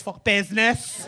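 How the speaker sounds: Scouse burst on BUSINESS, drawn out; Scouse twang. Retold or imitated. Comic teasing an audience member about Scouse twang, mock Scouse. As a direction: mimic a Greek speaker slipping into thick Scouse. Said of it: "sound really Greek at times, and then it's".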